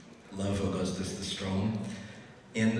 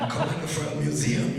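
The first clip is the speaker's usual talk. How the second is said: higher-pitched mock German caller; German accent; 6 semitones higher, 4 dB louder than usual. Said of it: "in a very heavy German accent".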